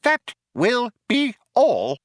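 high, slow, firm mouse voice. A narrator's reading. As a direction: close the matter, word by word. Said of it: "said Benji firmly".

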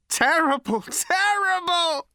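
high, loud scream rising on the repeat. A narrator's reading. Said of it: "he screamed".

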